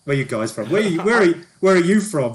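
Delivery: high, loud celebrity patter; self-important TV reporter. Acted. Plays TV reporter character.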